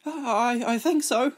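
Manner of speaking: put-on a small British boy stammering his answer; timid British boy. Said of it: "the boy spoke with a British accent".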